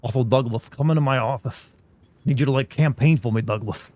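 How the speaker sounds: lightly voiced old politician; a hint of a stumping old senator; Mister Dink voice. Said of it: "I can talk like Mister Dink".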